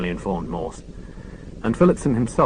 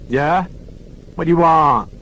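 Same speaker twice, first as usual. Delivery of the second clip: high, louder, curt woman on the phone; rude local woman; 8 semitones higher, 6 dB louder than usual. Acted. Woman answering Lewis's phone call curtly. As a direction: snap it like a suspicious local woman. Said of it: "A woman's voice".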